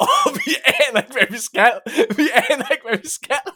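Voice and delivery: high laughing voice. Real, not acted. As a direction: laugh through the words. Laughing retelling, high pitch.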